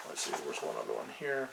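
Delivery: very low, barely voiced mumble; muttering to self. Real, not acted. Game master hunting through notes, muttering to self. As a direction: murmur it while searching a list.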